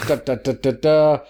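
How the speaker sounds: vocal "da-da-da" drum roll; imitated fanfare before a reveal; mock drum roll. Drum roll before random pick.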